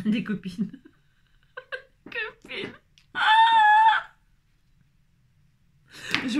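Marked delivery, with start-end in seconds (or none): 2.0-2.7 very high, soft, mock crying wail. Crafter joking about gifting skulls, very high mock wail. 3.1-4.1 very high, loud shriek. Real, not acted. Crafter shrieking after her joke, very high and loud.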